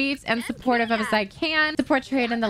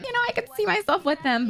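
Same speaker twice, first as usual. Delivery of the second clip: high, quick acted quote; girls with a crush; 8 semitones higher, faster than usual. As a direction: voice smitten girls, pitched up and quick.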